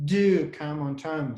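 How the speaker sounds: slow, strong DO, falling after; stress on DO. Shown as a demonstration. Teacher showing where emphasis goes, stress on DO. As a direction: stretch and hit the word Do. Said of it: "that you put emphasis there".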